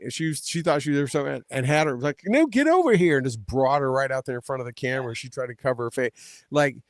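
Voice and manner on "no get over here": loud, high-pitched imitation of an order; retold bossy shout, jumping up high; mock summoning call. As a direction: call someone over, hammed-up and raised. Retelling Trump ordering her over.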